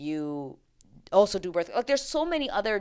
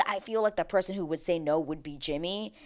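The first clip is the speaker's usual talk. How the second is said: ordinary talk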